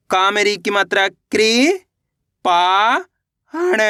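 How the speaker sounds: very slow, each syllable drawn apart. Shown as a demonstration. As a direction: spell it out slowly, piece by piece. (अक्षर-अक्षर धीरे-धीरे)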